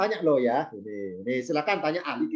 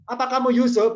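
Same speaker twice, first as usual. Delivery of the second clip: blunt question; 7 semitones higher, 5 dB louder than usual. Acted. Brothers bluntly questioning Yusuf.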